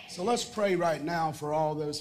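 ordinary delivery

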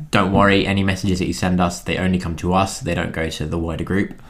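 normal talking voice

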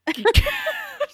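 high-pitched helpless burst of laughter. Real, not acted. Co-host reacting to the picture, high shocked laugh. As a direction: squeal with laughter.

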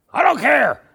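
high, loud dismissive yell; acted furious retort; angry shout. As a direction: cut the excuse off with a shout. Angry man cuts off preacher's excuse.

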